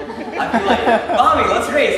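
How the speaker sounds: imitating his younger self calling to his mom, excited; excited kid. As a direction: squeal it like a five-year-old racing a cart.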